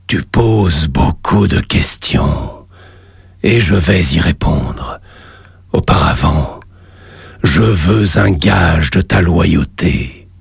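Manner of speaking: deep, slow, echoing demon god; cavernous voice. Acted. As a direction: boom it up from the lava below. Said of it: "une voix caverneuse".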